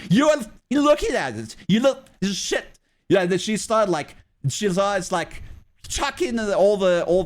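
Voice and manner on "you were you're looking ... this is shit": higher, louder mock yell of an accusing teacher; retold shout, much higher and louder, pointing the finger; imitating angry teacher. Teacher accusing child over chocolate.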